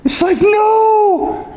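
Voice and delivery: high, slow stretched cry of no; drawn out no. Retold or imitated. Preacher protesting the long wait, high drawn out wail. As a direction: wail the no in mock despair. Protest at four hundred years.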